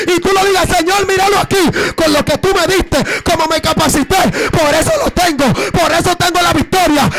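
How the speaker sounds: loud, tearful preaching cry; full-force preaching. Acted. Pastor urging the congregation, loud tearful preaching. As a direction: preach it out to the whole church.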